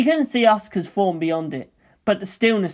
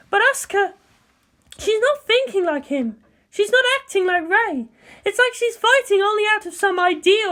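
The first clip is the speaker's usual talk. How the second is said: Misato's voice. Acted. Misato telling Ritsuko something's off, higher and uneasy. Misato worries about Asuka.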